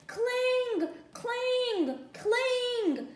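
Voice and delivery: high ringing clang; bell clang. A narrator's reading. Cow's bell rings out to wake the farmer, high. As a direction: ring out the bell.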